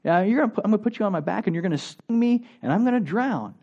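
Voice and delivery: high, startled turtle voice refusing; alarmed turtle. Acted. Turtle refusing to carry the scorpion, high alarmed voice. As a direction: squeak out the turtle's disbelief. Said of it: "the turtle says".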